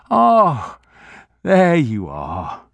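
lightly voiced relieved old man. A narrator's reading. Grocer relieved that Mackenzie comes round, slow. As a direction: let the worry drain out slowly. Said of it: "The old man's voice was relieved".

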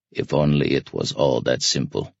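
low voice, as if talking to himself. A narrator's reading. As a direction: drop your pitch and muse to yourself. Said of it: "spoke in a low voice".

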